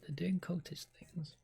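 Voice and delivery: ordinary talk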